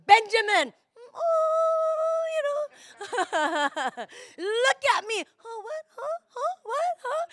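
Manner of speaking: high, teary hammed-up wail at husband; mock tearful rant. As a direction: act out a weepy tirade. Voicing herself snapping at husband.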